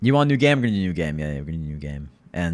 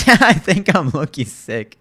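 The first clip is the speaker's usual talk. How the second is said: laughing; 4 semitones higher, 8 dB louder, slower than usual. Real, not acted. Delighted by gifts.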